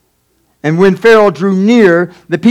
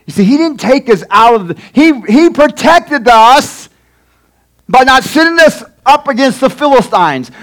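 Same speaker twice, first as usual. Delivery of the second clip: raised preacher's voice, driving the point home; higher-pitched, emphatic sermon delivery; impassioned preaching; 4 semitones higher than usual. Acted. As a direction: preach it out to the congregation. Preaches at full force.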